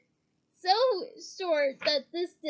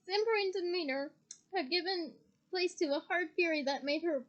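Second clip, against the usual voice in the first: usual speaking voice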